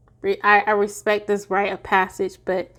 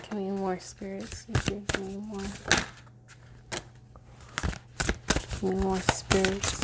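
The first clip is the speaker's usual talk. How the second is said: much softer, almost breathed request; hushed call; 2 semitones lower, 13 dB quieter than usual. Real, not acted. Reader softly calling on spirits while pulling cards, hushed. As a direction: murmur to the spirits for more.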